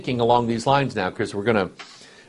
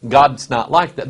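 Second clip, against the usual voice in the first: usual speaking voice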